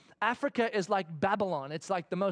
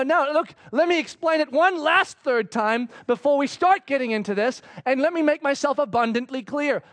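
higher, louder, firm and fed up; exasperated dad; 6 semitones higher, 8 dB louder than usual. Retold or imitated. Dad to daughter refusing his options, exasperated and firm. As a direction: lay down the law to a stubborn kid.

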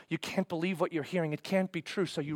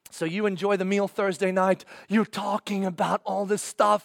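ordinary talk, nothing marked

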